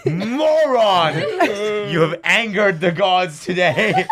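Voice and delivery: taunting brother after his bad frame; mock villain taunt. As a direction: lord it over him like a god.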